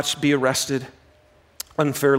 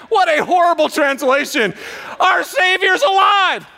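high, loud protest; indignant shout; 14 semitones higher, 5 dB louder than usual. Real, not acted. Pastor scorning a bible translation, high loud outcry. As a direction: shout it down as absurd.

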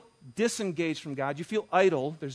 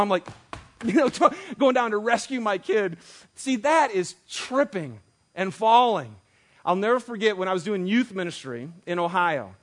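ordinary talk, nothing marked

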